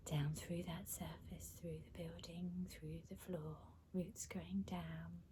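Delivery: soft, hushed meditation voice; guided meditation. Acted. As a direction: quietly guide them down through an imagined journey. Leads roots visualization.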